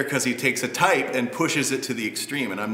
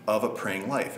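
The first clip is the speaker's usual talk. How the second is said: usual speaking voice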